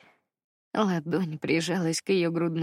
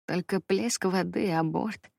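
usual speaking voice

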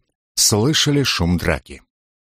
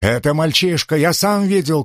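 shouting; 7 semitones higher than usual. A narrator's reading. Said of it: "крикнул другой".

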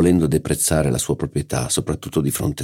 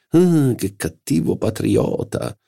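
slow, higher mock-scolding exclamation; exclaiming; 9 semitones higher, slower than usual. A narrator's reading. Charlus teasing the violinist, mild mock exclamation. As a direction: tease him with a drawn-out cry. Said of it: "esclamò Charlus".

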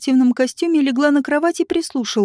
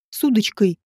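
ordinary delivery

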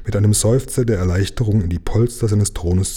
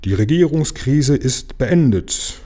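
slow, ceremonious royal proclamation, higher; solemn; 5 semitones higher, slower than usual. A narrator's reading. The king solemnly declares the crisis over, slow and stately. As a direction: announce it like a king ending a crisis. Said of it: "sprach er feierlich".

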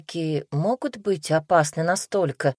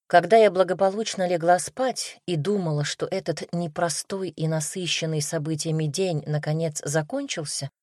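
usual speaking voice

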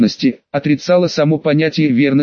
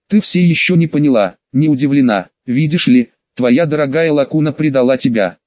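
usual speaking voice

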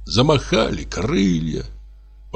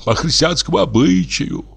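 rowdy reveller; 12 semitones higher than usual. A narrator's reading. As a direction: holler orders like a drunken gent. Carousing neighbour calls the servant.

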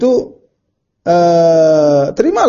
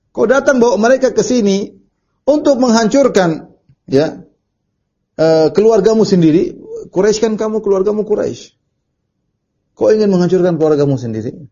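higher-pitched, mildly forceful reproach; retold harsh rebuke; 6 semitones higher than usual. Urwah confronts Muhammad over his own Quraysh family, mild harsh rebuke. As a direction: imitate a stern accusation, pitch raised. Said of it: "Perkataannya keras".

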